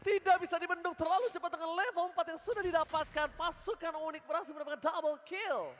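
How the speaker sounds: high, loud hype call; fighting game announcer. Real, not acted. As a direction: shout the double kill. Caster calls double kill.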